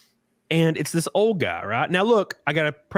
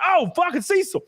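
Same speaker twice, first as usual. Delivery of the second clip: high-pitched re-enacted delight; mock-astonished high exclamation; excited recognition. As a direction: act out suddenly recognizing a friend, voice leaping up.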